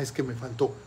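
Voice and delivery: usual speaking voice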